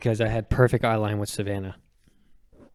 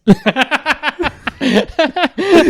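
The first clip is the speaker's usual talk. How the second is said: bursts into a loud, high laugh; 7 dB louder than usual. Real, not acted. Cracking up at the V six remark, loud high laugh. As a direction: cracks up hard at the memory. Hearing the funny car remark.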